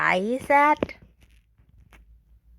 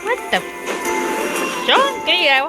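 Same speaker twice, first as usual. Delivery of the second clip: high-pitched startled outburst; startled protest; 8 semitones higher than usual. Real, not acted. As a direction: jump in alarm, voice shooting up. Startled by something in game.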